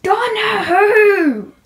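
high wail, stretched slowly; wailing drawn-out question. Acted. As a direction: cry out the punchline slowly. Running joke, higher and slower.

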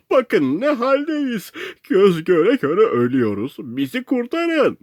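high, wailing plea for rescue; desperate shouting. A narrator's reading. Castaway pleads with the boat to save him, desperate shouting. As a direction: cry out with stretched words, pitch way up. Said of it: "diye bağırdım".

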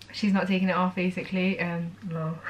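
fighting back tears; quiet tearful voice catching; real crying. Real, not acted. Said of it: "I don't know why I'm crying".

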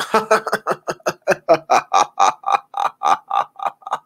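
long, drawn-out staccato laugh; exaggerated villainous ha-ha running on; hammed-up creepy laughter; mock creep cackle.

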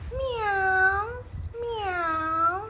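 high, drawn-out playful meow; cat meow. Pretend. Mother showing baby the cat, playful meowing.

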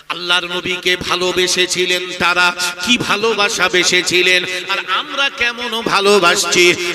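very loud, half-sung preacher's delivery; full-force preaching. Acted. Preacher retells the Prophet and Abu Bakr to the congregation, loud chanted preaching.

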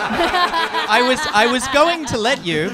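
laughing. Real, not acted. GM laughing at the rules.

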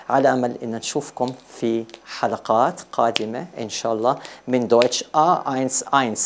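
ordinary talk, nothing marked

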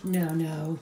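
ordinary talk, nothing marked

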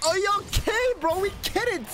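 very high, strained put-on voice; mock squeal. Player needles opponent who keeps at it, high mock squeal. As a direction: squeal it in mocking exasperation.

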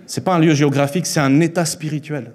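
normal talking voice

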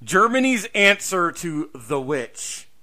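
ordinary delivery

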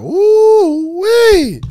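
high, loud woo-wee cry; a big, high, loud cheer; excited whoop. Real, not acted. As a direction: whoop it out, thrilled by the answer. Reacting to "quitting my job.

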